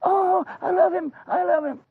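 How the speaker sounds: very high, gushing squeal; put-on high voice, besotted; overjoyed adoration. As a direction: coo ecstatically in a high voice. Overjoyed wife in the story.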